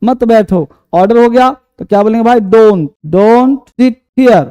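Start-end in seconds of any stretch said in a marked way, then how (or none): 2.4-4.5 very slow, word by word, stress on sit; slow dictation. Shown as a demonstration. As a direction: spell out "Don't, don't sit here" slowly for the class. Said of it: "teacher dictating slowly". (बहुत धीरे, "sit" पर ज़ोर)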